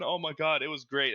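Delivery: ordinary delivery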